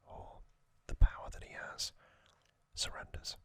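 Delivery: usual speaking voice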